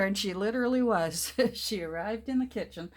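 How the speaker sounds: normal talking voice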